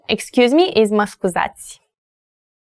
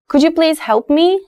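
polite question, higher with a rising end; polite request; 8 semitones higher than usual. Acted. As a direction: ask a stranger politely for help. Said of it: "I wanna be polite".

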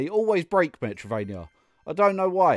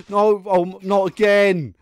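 louder, higher cry of frustration; dismayed protest; 5 semitones higher, 6 dB louder, slower than usual. Real, not acted. Player hit by the same trouble, dismayed cry. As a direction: protest loudly in real dismay. Same mishap happens in game.

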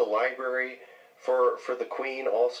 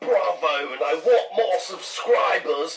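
high, stretched-out fake British accent; exaggerated British impression, dragging the key words; mock British accent; 7 semitones higher, 4 dB louder, slower than usual. American teasing a friend over his streaming, mock British accent. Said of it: "I think I have your accent down perfectly".

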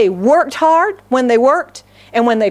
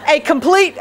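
ordinary talk

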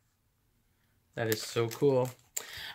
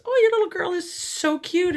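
high, louder gushing stranger voice; gushing lady; 6 dB louder than usual. Retold or imitated. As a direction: coo it like a doting stranger. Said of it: "this lady comes up to him and says".

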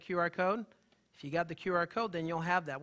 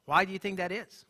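normal talking voice

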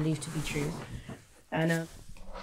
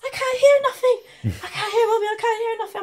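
put-on much higher and louder, a scared boy's plea; frightened son; 10 dB louder than usual. High son telling mum he can't hear, scared. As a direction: plead like a frightened teenager. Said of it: "he's like".

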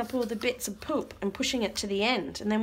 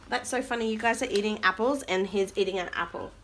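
ordinary delivery

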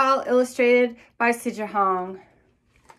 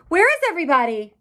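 higher, fast worried question; worried child; 6 semitones higher, 3 dB louder, faster than usual. Acted. Melba finding the house empty, higher and fast. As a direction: rush it out, a little alarmed.

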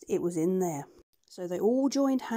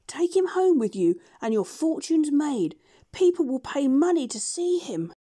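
higher, louder monkey voice; proud monkey; 7 semitones higher, 5 dB louder than usual. Acted. Chi-Chi presents the beast to the doctor, proud high monkey voice. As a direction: show off the find like a proud monkey. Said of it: "said Chi-Chi".

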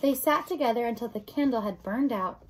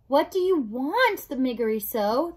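shouted question; 2 semitones higher, 5 dB louder than usual. Acted. Princess demands Mig's wish, loud shouted question. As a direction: call it out word by word. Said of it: "the princess shouted".

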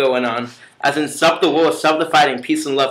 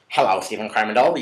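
lower, softer Stephen voice; speak like this; 8 semitones lower, 5 dB quieter than usual. Shown as a demonstration. Showing viewers how Stephen talks, lower and softer. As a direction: drop the voice low and soft. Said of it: "You have to also speak like this".

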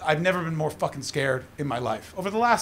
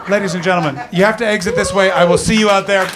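loud, rising higher as it builds; shouted sign-off; 9 semitones higher, 9 dB louder than usual. Real, not acted. Comedian thanking the crowd at show's end, loud building shout. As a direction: call out to the whole crowd, building to a high shout.

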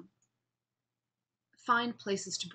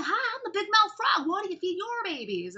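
big mouth frog; 11 semitones higher than usual. Acted. Frog accosts the alligator about her babies, big mouth voice. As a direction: play an overeager frog with a huge mouth. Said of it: "his mouth is really big".

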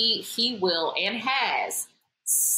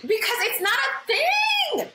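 high, drawn-out exasperated wail; exasperated protest; slower than usual. Real, not acted. Pressed on her aversion to astrology, exasperated protest. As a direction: push back high and stretch it out.

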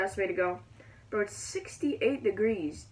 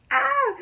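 short, high, loud scream; startled scream; 6 dB louder than usual. Real, not acted. Gamer as the tornado starts, startled scream. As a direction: yelp in sudden alarm.

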